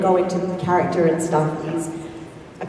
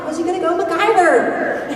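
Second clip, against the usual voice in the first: calling the dog; 11 semitones higher than usual. Retold or imitated. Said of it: "I'm like".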